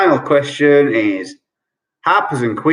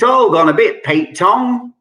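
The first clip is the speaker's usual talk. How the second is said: Cockney accent; 7 semitones higher than usual. Shown as a demonstration. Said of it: "I will try and do a Cockney accent".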